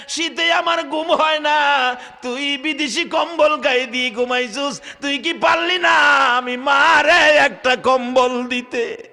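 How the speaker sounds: high, loud acted reproach; wailing mother. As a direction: chant it as an anguished cry to a neglectful son. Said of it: "কান্দে আর ডাকে".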